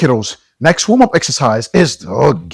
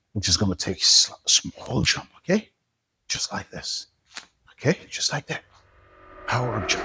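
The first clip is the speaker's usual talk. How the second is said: whispering; 2 semitones lower, 7 dB quieter than usual. Acted. Quietly demoing small jump.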